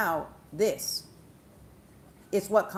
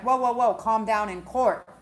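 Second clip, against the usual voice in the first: raised voice halting an outburst; mock courtroom intervention, louder; calling for order; 4 semitones higher, 7 dB louder than usual. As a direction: cut in loudly to settle the courtroom.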